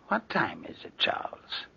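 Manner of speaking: unvoiced hushed question; whisper. Uncle quietly asks his nephew the time. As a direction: whisper it, wary of the guest.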